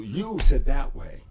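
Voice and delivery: loud, insistent protest; loud retort. Retold or imitated. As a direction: push back hard. Emphatic retort.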